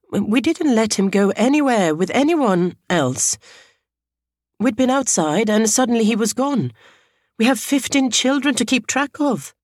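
high, pleading, upset voice; devastated. A narrator's reading. Teacher explaining how the boy went missing, devastated. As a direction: protest in high-pitched distress. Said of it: "She sounded as devastated as she looked".

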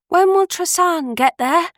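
calling out loudly across the boat; shouting. Acted. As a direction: shout it to the others. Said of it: "Annalie shouted to the others".

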